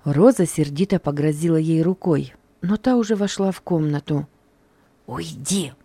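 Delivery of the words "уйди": sharp hushed order, softer; sharp whisper. A narrator's reading. Sharp hushed order. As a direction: maid hisses at the crying girl to leave. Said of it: "резко прошептала Роза".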